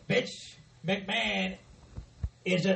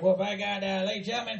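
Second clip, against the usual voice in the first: ordinary talk, nothing marked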